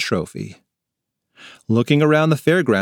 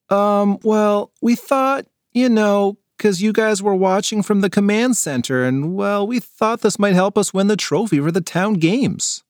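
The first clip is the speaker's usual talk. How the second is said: higher, halting and embarrassed; lightly voiced kid, hesitant; sheepish kid; 8 semitones higher than usual. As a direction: explain yourself awkwardly.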